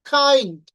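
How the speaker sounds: "কাইন্ড" loud, with aspirated k; correct pronunciation. Shown as a demonstration. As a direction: burst of air opening "কাইন্ড". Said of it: "not kind". ("কাইন্ড" জোরে, খ ধ্বনিতে)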